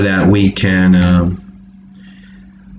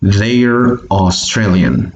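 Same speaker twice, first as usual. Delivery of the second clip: slow model reading; slower than usual. Shown as a demonstration. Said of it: "slow model sentence".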